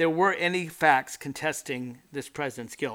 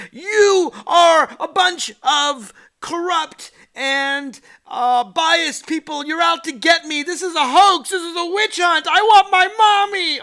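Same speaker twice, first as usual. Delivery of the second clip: put-on much higher and louder, slow whining like a crying child; whiny tantrum; 12 semitones higher, 11 dB louder, slower than usual. Guilty accused wailing at the police, hammed-up crying tantrum. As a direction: wail it out like a kid who wants his mommy.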